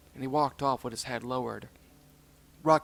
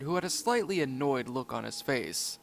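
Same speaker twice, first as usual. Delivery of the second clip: plain reading voice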